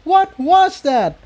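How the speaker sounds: slow, very high alarmed cry; startled by the blast; shocked shout. A narrator's reading. Incendia cries out as the room shakes, high shout. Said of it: "Incendia shouted".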